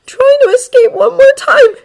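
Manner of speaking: high, loud, crying voice; choking tears. Acted. Izuku confessing the escape attempt, crying. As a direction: force the words through sobs.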